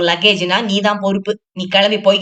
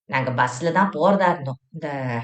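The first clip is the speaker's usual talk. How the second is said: a touch lower and softer, polite traveller; gently lowered voice asking to be seated; lightly voiced Shenbagam; 6 semitones lower, 5 dB quieter than usual. Shenbagam asking the seated woman to move, polite and low.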